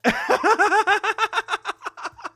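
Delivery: high, giddy laughter; excited laugh. Real, not acted. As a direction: laugh in disbelief. Celebrating the finish.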